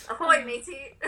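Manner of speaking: playful pirate voice. Pretend. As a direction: toss it off like a cartoon pirate. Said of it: "That sounds like a pirate".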